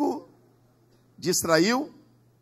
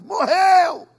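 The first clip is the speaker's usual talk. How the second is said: high, loud shout; yelled from below; 12 semitones higher, 13 dB louder than usual. Retold or imitated. A brother shouts from downstairs that he died, loud. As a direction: bellow up the news. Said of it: "O outro vai gritar lá de baixo".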